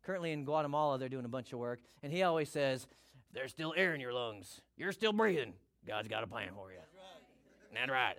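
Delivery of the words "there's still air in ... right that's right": low-pitched impression of a missionary friend; friend's manner of talking. Preacher quoting his missionary friend, impersonated. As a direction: imitate your friend's encouraging catchphrase, mostly low. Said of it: "That's how he talks".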